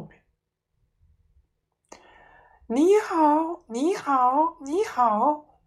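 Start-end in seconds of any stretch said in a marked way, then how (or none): 2.7-5.6 high, squawking parrot greeting; much higher parrot voice. A narrator's reading. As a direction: chirp it like a bird. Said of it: "那声音又来了".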